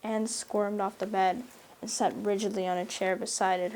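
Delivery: ordinary delivery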